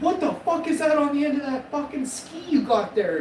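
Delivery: roast him at the top of your voice; mock outraged yell. Mocking friend's big toe.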